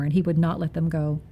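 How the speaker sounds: ordinary delivery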